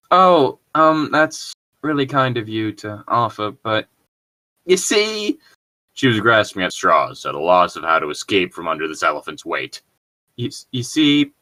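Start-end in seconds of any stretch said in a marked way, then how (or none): 0.1-5.4 put-on flustered girl voice. 10.4-11.3 put-on flustered girl voice. Girl voice continues.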